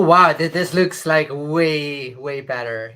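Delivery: usual speaking voice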